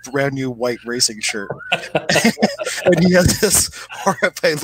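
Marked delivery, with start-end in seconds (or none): 1.5-2.8 breathy laugh. Real, not acted. Mum laughing at spilled cocoa.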